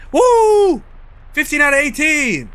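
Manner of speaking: high, happy whoop; excited cheer. Real, not acted. Cheering a passing score.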